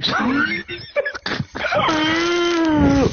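shrieking burst of laughter. Real, not acted. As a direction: howl with laughter. Bursting out at rude remark.

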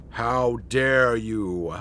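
low, very slow mock outrage; affronted. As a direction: draw each word out low and slow. Offended character in skit.

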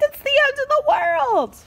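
shrieking panic; panicked shriek. Acted. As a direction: scream in terror. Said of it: "Pencil shrieked".

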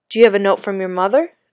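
quick, loud Jamaican guard; brisk question in a Jamaican accent. Acted. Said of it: "spoke with an accent that Danny really liked".

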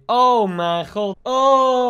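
long drawn-out exclamation, rising at the end; overdreven uitroep. Real, not acted. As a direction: stretch every word in dramatic dismay. Spottende reactie op paardrijden in verhaal. (langgerekt en dramatisch uitroepen)

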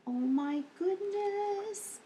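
ordinary talk, nothing marked

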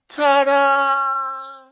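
high showman flourish; stage magician reveal. Pretend. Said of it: "count to three".

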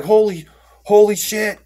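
high, slow stunned swearing; retold shock. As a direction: stretch the oath in disbelief. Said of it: "I was like".

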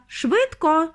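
high, urgent order; commanding call. A narrator's reading. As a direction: hurry them along sharply. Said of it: "наказав містер Вонка".

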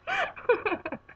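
high burst of laughter; laughing. Real, not acted. Embarrassed after trying an accent alone in the garage, high laughing. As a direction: crack up at your own silliness.

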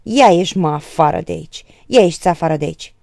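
loud, scornful order; loud and contemptuous. A narrator's reading. Victor ordering Birică out, loud and contemptuous. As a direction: throw him out with contempt. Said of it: "spuse tare și disprețuitor spre Birică".

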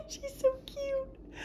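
tiny, high, giggly coo; adoring coo. Real, not acted. Collector admiring her cute doll, adoring high coo. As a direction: squeak it softly and slowly, adoring.

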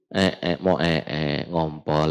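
imitating a child. Voicing child who wet himself.

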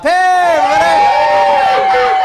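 high, loud holler; calling out. Real, not acted. Host hailing passers-by at a bus stop, calling out. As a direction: shout a big hello across the street.

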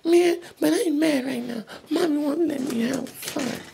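normal talking voice

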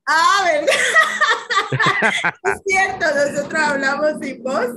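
loud, high laughing reply. Real, not acted. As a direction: laugh it off, delighted.

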